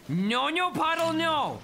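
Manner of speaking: high, loud cry of protest; alarmed yell. Real, not acted. Player yelling at Puddle to stop, alarmed and high. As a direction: call out urgently to stop them.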